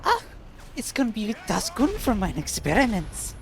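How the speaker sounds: hammed-up German accent, mad-scientist flair; a flamboyant German scientist showing off his experiments. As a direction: play the over-the-top German scientist. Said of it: "the flamboyant German scientist voice actor".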